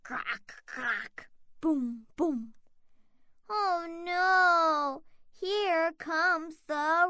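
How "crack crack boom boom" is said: thunder sounds. Acted.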